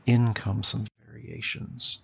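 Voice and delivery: ordinary talk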